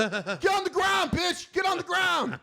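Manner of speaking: high, drawn-out shouted orders; mock commanding shout. Self-styled peacemaker ordering people down, high mock shouting.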